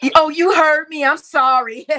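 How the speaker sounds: loud, high mock of her sing-song apology; loud mocking imitation of the woman's apology. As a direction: parrot her back mockingly. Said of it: "Y'all heard that?".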